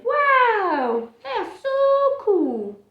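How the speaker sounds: high, loud, slow gushing; squealing admirers; awestruck fans. Acted. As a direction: gush like starstruck kids. Admiring beans voiced.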